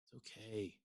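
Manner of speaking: a quiet calm it's okay; soft okay. Real, not acted. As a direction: breathe it out gently. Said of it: "And I just laugh".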